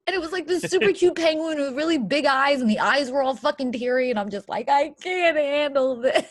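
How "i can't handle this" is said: high, loud, drawn-out whine; mock tearful wail. Woman undone by teary-eyed cartoon penguin, mock tearful wail. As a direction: wail it as if overwhelmed by cuteness. Acts out crying over the penguin.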